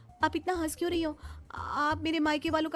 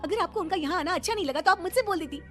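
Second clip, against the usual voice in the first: angry; 7 semitones higher than usual. Acted. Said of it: "Surabhi का गुस्सा चौथे आसमान पर था".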